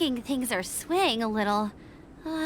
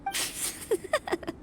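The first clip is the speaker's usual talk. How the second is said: light, high, quiet giggle; a small, breathy giggle; soft giggle; 10 semitones higher, 5 dB quieter than usual. Acted. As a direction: giggle softly to yourself. Amused by friend's stern care.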